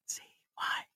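hushed, whispered answer imitating his wife. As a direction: whisper it back like someone in hiding. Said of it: "She whispers".

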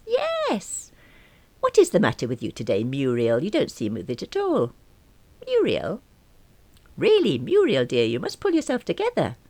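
put-on high, exasperated elderly voice; a bossy old dear; scolding old lady. Old caller scolds confused friend.

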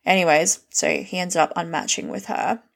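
ordinary delivery

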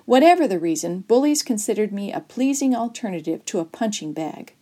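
ordinary delivery

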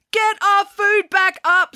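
high, slow, strained scream; outraged scream. A narrator's reading. Jessica orders Ian to dive for sunken food, screaming. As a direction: scream the order, stretching each word. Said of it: "screamed Jessica".

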